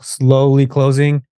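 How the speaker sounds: slow-motion narration, drawing out "slowly"; drawn-out slow. Real, not acted. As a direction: stretch SLOWLY right out, at half speed. Describing a door closing in slow motion.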